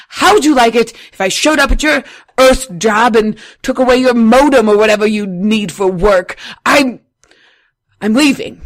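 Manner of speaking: loud, furious tirade; angry rant. Acted. The radio host yelling at listeners for not calling in, furious and loud. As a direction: blow up at listeners who won't call. Host rants at absent callers.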